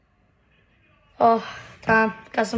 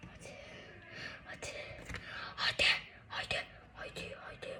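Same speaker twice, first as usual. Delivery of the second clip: whispering; 6 semitones higher, 17 dB quieter, faster than usual. Real, not acted. Willing piece to fit.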